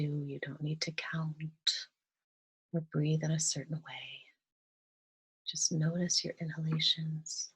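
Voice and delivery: soft, calm meditation voice with long pauses; guided meditation. Real, not acted. Guide leading listeners through breathing and gratitude, softly meditative. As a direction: gently guide them into relaxation, leaving space between phrases.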